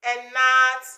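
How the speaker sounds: ordinary delivery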